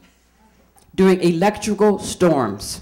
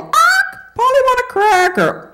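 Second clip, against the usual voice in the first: high squawking parrot, far up in pitch; 13 semitones higher than usual. Retold or imitated. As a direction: squawk it like a parrot begging for food. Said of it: "everybody talks when they're hungry".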